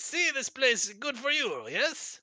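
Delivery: high, fawning broken-English host; dictator voice. Acted. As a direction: show off the tiny beds eagerly. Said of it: "this dictator voice".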